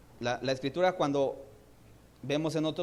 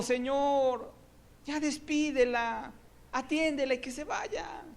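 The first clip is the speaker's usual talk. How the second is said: high, slow pleading cry; mock shout; 11 semitones higher, slower than usual. The woman crying after Jesus, in a high, slow pleading cry. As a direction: call out like a desperate woman chasing after him. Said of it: "Viene gritando".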